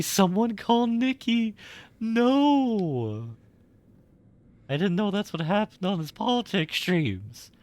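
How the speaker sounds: high, loud panicked exclamation; alarmed game character. Acted. Streamer voices a shocked character reading game dialogue, alarmed. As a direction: cry out for help like a startled townsperson.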